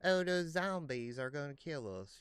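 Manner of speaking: hint of a Southern panic; mock Southern accent. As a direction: play the Georgia heroine. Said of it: "a southern accent".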